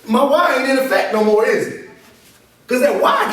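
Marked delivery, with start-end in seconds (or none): none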